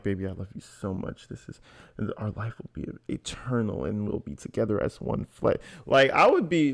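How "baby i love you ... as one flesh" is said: soft, mock-tender whine; lightly voiced lovesick Paul, hushed. Commentator mocks Paul's call to his wife, mock-tender and hushed. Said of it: "imagine Paul curled up in the corner like".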